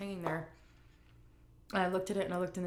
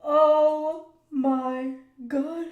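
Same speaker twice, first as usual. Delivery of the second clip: very slow, louder, higher oh my God; remembering the injury, slow loud mortified groan; drawn-out groan; 9 semitones higher, 11 dB louder, much slower than usual. Real, not acted. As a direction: stretch it out in mortified disbelief. Embarrassed groaning reaction.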